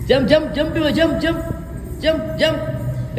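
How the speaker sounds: calling out counts. Real, not acted. Coach calling jumps to kids.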